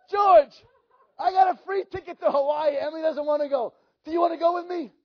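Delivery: hyped-up invite; excited offer. Retold or imitated. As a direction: sell the free trip with excitement. Offering free ticket, calling out.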